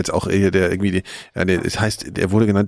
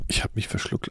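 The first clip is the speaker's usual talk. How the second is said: whisper; 9 dB quieter than usual. Pretend. Teasing the choking friend.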